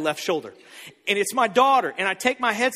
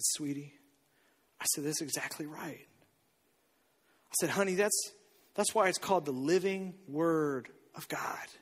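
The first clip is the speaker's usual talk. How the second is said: much softer, hushed, ending in a whisper; tender father; 5 semitones lower, 10 dB quieter than usual. Retold or imitated. Father tenderly telling his daughter she got it right, hushed. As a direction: speak gently to your little girl.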